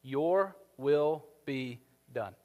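each word slowed and set apart; slow, weighty quote. Shown as a demonstration. Preacher names the words everything revolves around, slowly. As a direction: lay the four words down slowly. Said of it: "these three words".

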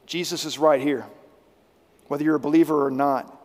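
ordinary delivery

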